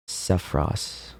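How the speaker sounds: breathy slow whisper of a name. Acted. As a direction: whisper it slowly, with drama.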